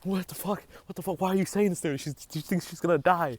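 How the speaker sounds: high, loud incredulous outburst; outraged disbelief. Retold or imitated. Outraged at mom for scaring her sister, high and loud. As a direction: blurt it out high and loud, appalled.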